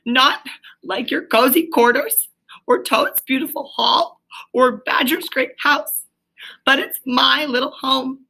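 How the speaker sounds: high, broken, tearful pleading; sobbing brokenly. A narrator's reading. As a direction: voice cracking with homesick grief. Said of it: "he sobbed forth at last brokenly".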